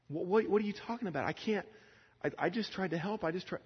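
very high, stammering, helpless pleading; flustered protest. Retold or imitated. Man telling her he tried to help, high flustered protest. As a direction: stammer out a bewildered defence.

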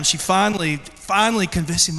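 usual speaking voice

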